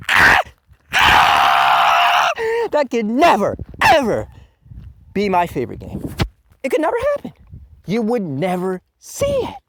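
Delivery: loud, high yelling, fully worked up; a ranting gamer yelling at a cheap game; furious shout. Real, not acted. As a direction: bellow it in outrage. Furious rant about mobile game.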